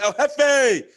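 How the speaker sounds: loud, high hail of the nickname; calling out a nickname. Retold or imitated. Calling to his friend El Jefe across the lot, loud and high. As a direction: shout the nickname to get his attention.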